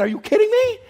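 high squeaky disbelief with a laugh; incredulous squeak. Acted.

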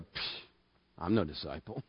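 a soft, low scoffing aside; dismissive scoff. Retold or imitated. Preacher mocks himself as reader, low scoff. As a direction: brush it off under the breath.

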